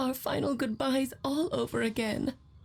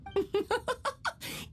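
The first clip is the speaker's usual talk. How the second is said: amused laugh; 11 semitones higher than usual. Acted. Alice laughs at late arrival.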